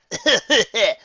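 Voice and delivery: loud high cackle; rowdy laugh. Acted. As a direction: laugh it up rowdily. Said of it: "Scuffle laughed".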